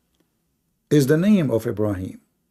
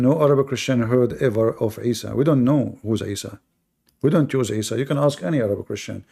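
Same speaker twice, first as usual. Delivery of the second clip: ordinary talk, nothing marked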